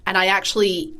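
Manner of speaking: ordinary delivery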